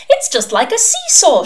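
put-on high, loud childish delight; gleeful. A narrator's reading. Suspect enjoying the ducking stool, gleeful. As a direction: squeal it like a child at play.

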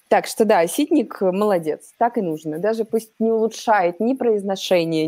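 usual speaking voice

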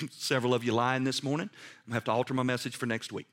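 normal talking voice

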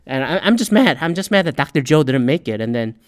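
ordinary talk, nothing marked

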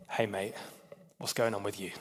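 soft, low, casual murmur; gentle whisper, voiced. The Lord speaks to Elijah after the fire, gently hushed. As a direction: imitate God's gentle voice, hushed and matey. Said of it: "came a gentle whisper".

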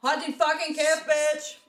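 playful fake rage, shouted; mock furious yell. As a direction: bark it at the rude couple, hammed-up. Mock outburst at rude customers.

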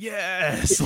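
high, drawn-out cheer. Retold or imitated. Husband recalling his and his wife's reaction at the movie, cheering. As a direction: whoop it like a delighted movie crowd. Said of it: "my wife and I just cheering on that".

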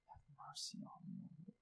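breathless whispered plea for mercy. Acted. Pleading woman whispers to Jesus for mercy. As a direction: let the plea sink to a whisper.

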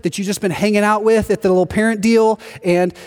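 ordinary talk

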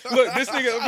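usual speaking voice

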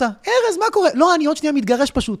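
very high, exaggerated friendly hello; mock cheery greeting. Friend mimics him greeting passers-by mid-fight, high cheery voice. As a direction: chirp greetings as if nothing is wrong.

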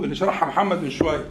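ordinary talk, nothing marked

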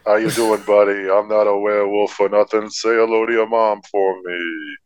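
easy, smooth lounge-singer charm. Retold or imitated. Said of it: "sounds like an old [name] impression".

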